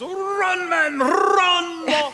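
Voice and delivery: Scottish fan yelling at a baseball game; Scotsman yelling. Acted. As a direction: shout it like a Scots fan in the stands. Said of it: "the Scotsman stands up and yells".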